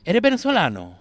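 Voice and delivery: put-on a touch of a Latin American Spanish accent. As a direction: quiz him like a curious local. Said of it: "So people".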